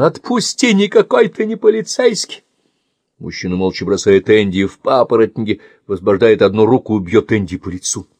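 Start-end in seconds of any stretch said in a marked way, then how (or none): 0.0-2.4 much higher, louder cry; struggling shout. Acted. Pinned Andy screams at the man, struggling shout. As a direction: fight back with a yell. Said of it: "кричит он".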